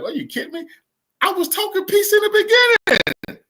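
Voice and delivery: high-pitched, building to a loud squeal; incredulous mock Putin. Commentator voices Putin protesting he talked peace, incredulous squeal. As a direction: start high and disbelieving, end in a loud yelp. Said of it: "Putin laughed like".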